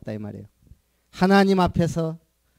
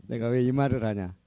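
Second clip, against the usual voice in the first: ordinary talk, nothing marked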